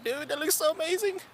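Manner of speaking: high, put-on gushing squeal; gushing falsetto. Streamer retelling his gush over a girl's leggings. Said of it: "I'm like".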